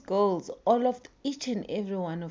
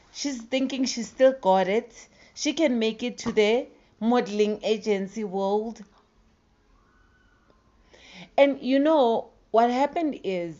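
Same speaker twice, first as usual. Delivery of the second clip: heated loud commentary; 4 semitones higher, 4 dB louder than usual. Real, not acted.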